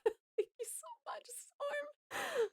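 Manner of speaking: high, hushed whisper of thanks; soft whisper after a little laugh. Real, not acted. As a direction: whisper it, too moved to speak aloud.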